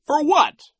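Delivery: very high, loud question; incredulous Nero. A narrator's reading. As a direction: demand an answer in disbelief.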